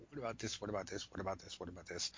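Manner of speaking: soft, rapid-fire repeated asking; low-key mock pestering; imitated pestering questions.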